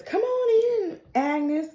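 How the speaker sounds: high-pitched mock sing-out; creepy dentist. Creepy dentist calls Agnes in, mock inviting. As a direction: lightly play a dentist beckoning a patient.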